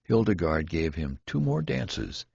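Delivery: ordinary delivery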